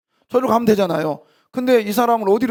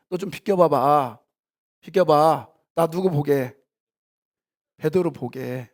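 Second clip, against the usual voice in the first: gentle request; 4 semitones lower, slower than usual. Acted. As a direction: ease him out of the way kindly. Jesus gently asking to step aside.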